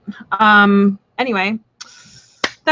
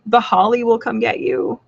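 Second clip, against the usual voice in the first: usual speaking voice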